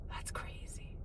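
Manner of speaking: breathy whisper, barely audible; whispered aside. Real, not acted. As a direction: drop to a secret whisper. Quiet aside while reading plate.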